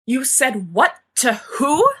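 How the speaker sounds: high, loud, slow disbelieving cry; shriek. A narrator's reading. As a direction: shriek in shock at the news. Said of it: "James shrieks".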